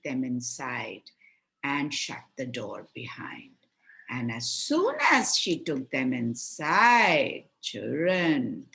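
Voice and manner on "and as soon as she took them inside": plain reading voice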